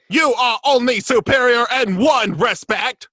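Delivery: grate it out like a Dalek. Retold or imitated. Quotes Dalek line.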